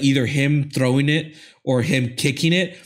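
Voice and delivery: ordinary talk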